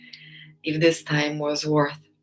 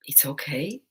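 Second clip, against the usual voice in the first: whispered reassurance; 2 semitones lower, 7 dB quieter than usual. Real, not acted. Soft reassuring aside.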